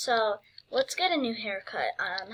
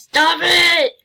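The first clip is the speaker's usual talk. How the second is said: overwhelmed shout; 6 semitones higher, 15 dB louder than usual. Real, not acted. Gamer shouting at a generous donor, overwhelmed and loud.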